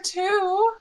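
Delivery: normal talking voice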